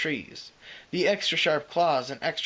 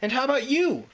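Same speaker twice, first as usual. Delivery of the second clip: threw back; 10 semitones higher, 5 dB louder than usual. A narrator's reading. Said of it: "she threw back".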